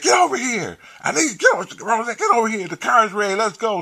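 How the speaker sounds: high, loud urgent summons; urgent calling over. Retold or imitated. Man calling Rosette away from the drug boys, urgent high call. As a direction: call her over insistently, pitched high.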